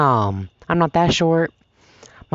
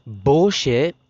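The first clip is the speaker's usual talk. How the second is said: ordinary delivery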